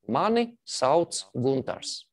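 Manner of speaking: mani sauc Guntars, stress on mani, slow; slow Latvian phrase. Shown as a demonstration. Teacher introducing himself in Latvian to learners, slowly. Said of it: "let me read it out".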